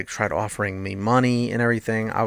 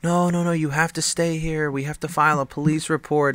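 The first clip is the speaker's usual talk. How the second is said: higher-pitched imitation of worried moms; mock motherly insistence, pitched up; insistent mothers; 6 semitones higher than usual. Mothers telling the kid to stay, insistent. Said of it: "So all the mothers are like".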